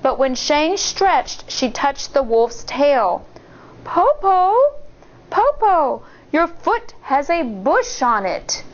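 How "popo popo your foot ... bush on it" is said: put-on high puzzled little girl; high child voice; child's voice. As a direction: ask like a wary child. Child questions wolf in bed.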